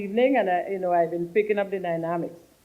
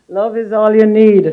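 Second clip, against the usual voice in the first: laughing it out, much louder; 5 semitones higher, 17 dB louder than usual. Real, not acted. Minister joking to guests about Grenada, laughing loudly. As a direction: burst into a laughing shout of "Love is all you need".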